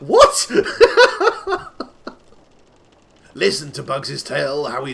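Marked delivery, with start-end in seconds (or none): 0.0-0.5 much higher, loud incredulous squawk; real startled outburst; shocked yelp. Real, not acted. Shocked by a nonsense line. 0.6-2.1 loud, very high cackling laugh; real shrieking laugh; high burst of laughter. Real, not acted. Laughing at the nonsense line.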